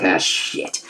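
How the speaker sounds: a soft whispered swear; whispered curse. Retold or imitated. Steve realizing he's met a gangster, whispering a soft curse. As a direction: breathe it out in dismay. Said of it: "Which Steve's like".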